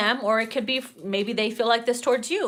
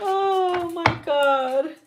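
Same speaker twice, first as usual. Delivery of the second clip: drawn-out oh my God; 6 semitones higher, much slower than usual. Real, not acted. Recovering from laughter.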